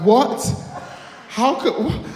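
high, loud squawk of disbelief; acted shock. Youth hearing from his mate that he was used, shocked. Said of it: "I was so shocked".

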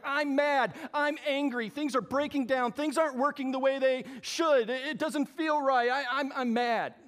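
loud, high, hammed-up angry rant, slowing at the end; mock angry complaint. Caller ranting to the manufacturer, hammed-up angry and loud. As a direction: play the fed-up customer yelling down the phone. You're like.

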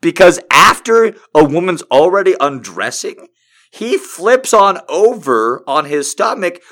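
usual speaking voice

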